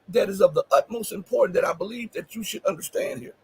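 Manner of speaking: ordinary talk, nothing marked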